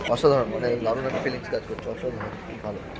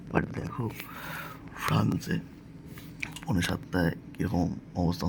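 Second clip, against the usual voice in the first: speaking softly; 5 semitones lower, 7 dB quieter than usual. Real, not acted. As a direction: talk softly so no one wakes. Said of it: "খুব আস্তে কথা বলছি।".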